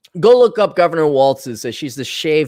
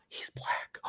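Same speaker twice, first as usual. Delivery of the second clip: a hushed, breathy mock-secret whisper; whispered aside; 21 dB quieter than usual. Whispering to the audience about his buddy, mock secrecy. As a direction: drop to a whisper as if it's scandalous.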